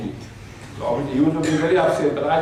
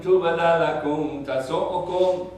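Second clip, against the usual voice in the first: higher, fast melodic tajweed chant; Quran recitation; 6 semitones higher, much faster than usual. Acted. Woman deflecting his advance with a chanted verse. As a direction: sing out the verse as a reciter would.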